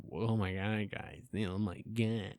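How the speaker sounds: quietly appalled at the tank; hushed dismay. Retold or imitated. Visitor seeing the dirty tank, softly appalled. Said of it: "you're gonna be like".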